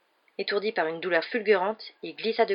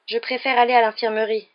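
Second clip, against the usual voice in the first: raised, strained insistence; tight, loud and higher, holding back pain; through gritted teeth; 5 semitones higher, 7 dB louder than usual. A narrator's reading. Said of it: "les dents serrées".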